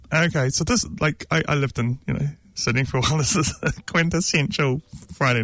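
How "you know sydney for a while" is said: ordinary talk, nothing marked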